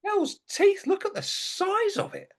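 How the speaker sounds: shocked exclamation. Acted. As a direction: recoil at the sight of it. Shocked at his own liver.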